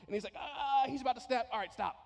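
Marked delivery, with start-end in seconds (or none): 0.4-1.5 much higher and louder gloating cry; mock gleeful God. God voiced gloating as the believer nears breaking. 1.5-1.9 higher, louder offhand stop; mock God calling halt. God voiced halting the pile, offhand.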